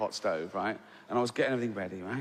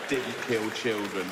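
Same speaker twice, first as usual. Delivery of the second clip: stunned repeat; 4 semitones lower, slower than usual. Real, not acted. Repeating the woman's claim to the audience, slow stunned disbelief. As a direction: let the words sink in, slowly and low. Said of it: "repeats her words in disbelief".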